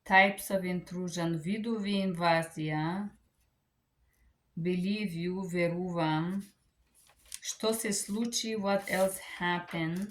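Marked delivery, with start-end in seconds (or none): none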